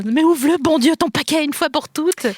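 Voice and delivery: loud, high exasperated yell; impatient outburst. Pretend. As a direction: hurry them up, exasperated. Mock impatient outburst at cookies. (cri exaspéré)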